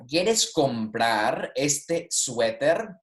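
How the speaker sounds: very slow Spanish example question; slow model question. Shown as a demonstration. Teacher reading a sweater question to students, slow model reading. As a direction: draw the question out for learners.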